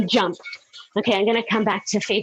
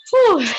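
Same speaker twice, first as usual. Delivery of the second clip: loud relieved exhale; 10 semitones higher, 6 dB louder than usual. Real, not acted. End of hard interval.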